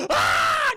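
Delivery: high, loud scream of pain; hammed-up shriek of pain; mock scream. Tuco screams as his fingertips get cut, mock shriek. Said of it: "Just starts screaming".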